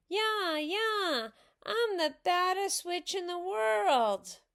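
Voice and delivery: high, loud playground taunt; boastful, sing-out jeer; taunting yell. A narrator's reading. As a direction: crow it in a put-on high voice. Ramona taunts Susan in character.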